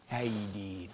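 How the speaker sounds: low, quiet put-on drawn greeting; funny greeting impression. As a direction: imitate the maniac's low goofy greeting. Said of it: "He used to do this funny thing".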